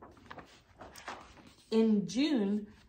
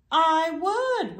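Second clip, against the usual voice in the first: high and loud, eager; bright eager reply; 9 semitones higher, 10 dB louder than usual. A narrator's reading. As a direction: shine with eagerness. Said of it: "replied the lanternfish".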